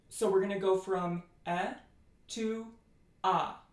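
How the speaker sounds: eh opening into ah as the jaw drops. Shown as a demonstration. Teacher demos the move from schwa to Tiefschwa. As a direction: start on eh and drop the jaw into ah. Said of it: "drop your jaw".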